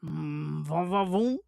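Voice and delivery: lightly voiced French charmer, va va voom; mock French accent, teasing. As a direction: purr it like a cheesy French charmer. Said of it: "he talks like a French".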